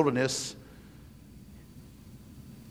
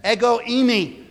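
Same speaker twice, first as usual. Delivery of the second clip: higher, drawn-out Ego eimi; proclaimed Greek; 7 semitones higher than usual. Shown as a demonstration. Preacher proclaiming Jesus' Greek words, raised. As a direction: ring out Ego eimi as a declaration. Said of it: "When Jesus says".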